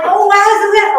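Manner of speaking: high, delighted "Oh, wow"; excited wow. Pretend. Teacher voices a listener's thrill, excited. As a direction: sound thrilled to find it. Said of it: "Can you get me all like".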